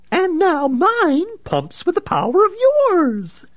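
high, loud, cackling gloat; manic villain. Acted. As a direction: crow with mad laughter.

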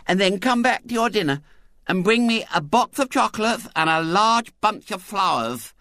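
high piping child playing wife; grand, imperious small-girl chatter; bossy lisping little girl. A narrator's reading. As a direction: order him about primly.

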